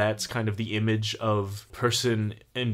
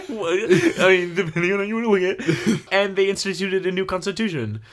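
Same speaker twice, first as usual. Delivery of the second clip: higher, laughing sarcastic aside; 7 semitones higher, 4 dB louder than usual. Real, not acted. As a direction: laugh while excusing the purges. Sarcastic mock justification of purges.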